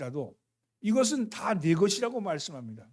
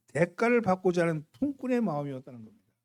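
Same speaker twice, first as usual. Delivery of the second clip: ordinary talk, nothing marked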